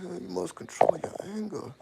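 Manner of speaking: imitating son scolding dad; son's serious advice. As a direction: lecture like the wise son. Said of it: "That's how he talk".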